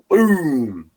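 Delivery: big vocal rifle blast; imitated gunshot. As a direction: mimic a rifle going off.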